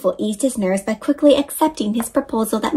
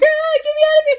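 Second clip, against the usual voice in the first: much higher frightened cry; panicked; faster than usual. Acted. Bruce fleeing in panic.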